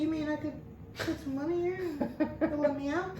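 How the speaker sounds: high, wide-eyed mock disbelief; mock amazement. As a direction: play it naive and amazed. Mock naive amazement, retold.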